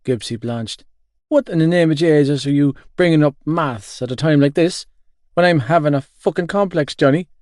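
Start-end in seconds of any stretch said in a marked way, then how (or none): 1.3-7.3 loud, high indignant rant; worked-up, raised voice; exasperated outburst. Acted. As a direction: protest in comic outrage. Gibbsy in comic outrage at friend.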